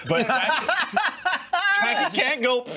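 high, slow, laughing through it. Real, not acted. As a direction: squeal it out in laughter. Laughing about girlfriend.

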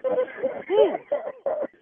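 rire aigu de soulagement; éclate de rire en comprenant la blague; surprised laugh. Real, not acted. Laughs after realizing prank.

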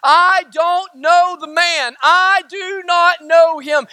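loud, slow, emphatic declaration; Peter. Retold or imitated. Preacher voicing Peter's denial, loud declaring. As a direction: raise the voice and stretch each word of the denial. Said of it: "Peter declared".

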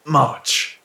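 ordinary delivery

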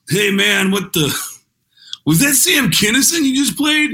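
high-pitched, amazed caller; radio caller. Retold or imitated. Caller asks DJ about Sam Kinison, high amazed voice. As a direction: call in high and incredulous.

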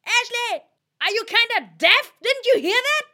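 very high, piercing shout; screaming. Acted. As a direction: shriek it at full volume. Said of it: "Oh, fuck, that was loud".

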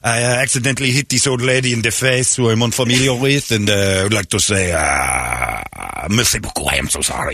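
mock French accent; hammed-up French athlete; Victor Wembanyama. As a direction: apologize formally in a thick French accent. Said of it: "My name is Victor Wembanyama".

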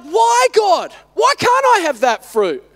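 high mock-childish protest on "Why"; protesting little son. Preacher questioning God like his little son, childlike protest. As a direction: complain to God like a little kid. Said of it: "I feel like my little son".

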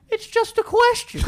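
high squeaky put-on voice of the guy; creepy high voice. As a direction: squeak it out high, then laugh. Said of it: "And he goes".

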